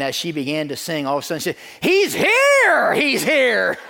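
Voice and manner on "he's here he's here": very high, loud, drawn-out cry; hammed-up squeal of revelation; ecstatic mock shriek. Singer suddenly hearing reverb in her earbud, ecstatic acted shriek.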